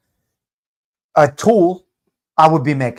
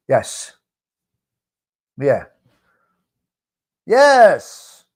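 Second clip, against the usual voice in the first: ordinary delivery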